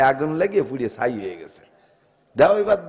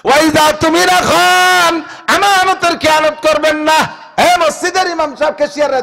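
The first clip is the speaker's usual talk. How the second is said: ringing preacher's chant; full-force preaching; 12 semitones higher, 7 dB louder than usual. Acted. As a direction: call it out to the whole mosque.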